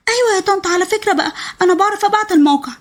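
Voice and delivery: put-on high child's voice, a bit steadier now; a small boy eager to help, high and childlike; little boy. Boy Zein telling his aunt he can send the location, high eager child voice.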